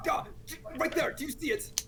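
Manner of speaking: high-pitched urgent insistence; urgent pointing out. Acted. Armored fighter showing his companion the floating figure, urgent.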